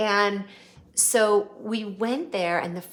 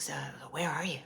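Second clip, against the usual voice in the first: imitating [name]'s whisper; 3 semitones lower, 7 dB quieter, much faster than usual.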